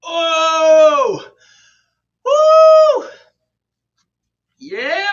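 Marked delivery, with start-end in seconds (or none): 0.1-3.1 loud high whoop after effort; relief shout. Real, not acted. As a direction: let out a big victory whoop.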